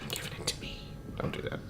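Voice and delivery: mock whispered demand. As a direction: hush it playfully. Playful aside.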